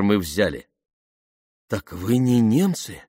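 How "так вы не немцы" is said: astonished, drawn-out question rising higher; amazed. A narrator's reading. Rescued woman learns they aren't Germans, amazed. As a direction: ask in slow, dawning amazement. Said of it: "изумилась она".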